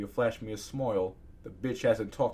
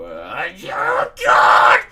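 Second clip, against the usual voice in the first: despairing scream; 4 semitones higher, 15 dB louder, much slower than usual. Real, not acted. Reader overwhelmed by more accents to invent, despairing scream. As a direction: groan rising into a very high scream.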